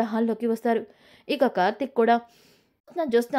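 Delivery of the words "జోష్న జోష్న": usual speaking voice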